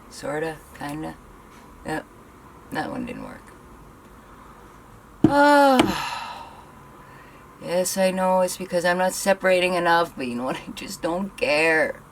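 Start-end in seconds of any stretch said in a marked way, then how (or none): 5.3-6.5 high, louder wail of frustration; frustrated cry. Real, not acted. As another curl fails, frustrated cry. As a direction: let out a fed-up cry. Curl failed yet again.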